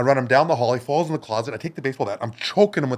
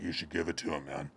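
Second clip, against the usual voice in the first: much lower, quiet heavy voice; low quiet tough guy; 7 semitones lower, 14 dB quieter than usual. Retold or imitated. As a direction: rumble it low like a bouncer. Big bouncer advises.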